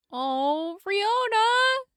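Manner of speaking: high, loud cry of her name; alarmed call. Acted. Friend calls out in alarm.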